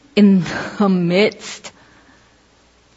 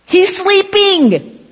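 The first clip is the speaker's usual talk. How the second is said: stretched out; 12 semitones higher, 4 dB louder, much slower than usual. Acted. Jesus asleep in storm.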